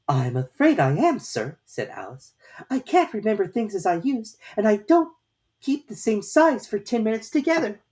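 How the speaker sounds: put-on high, light young girl voice; a polite little girl, voiced high; Alice's girlish voice. A narrator's reading. Alice answering the caterpillar in a high girlish voice. Alice explains her trouble.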